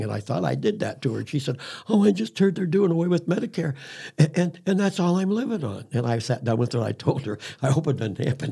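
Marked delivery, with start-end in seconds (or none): 1.9-5.8 higher-pitched imitation of a tearful little old lady; retold crying. A little old lady tells the speaker her Medicare fears, imitated tearful voice. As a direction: plead in a high voice, lightly imitating someone crying. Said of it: "She was crying".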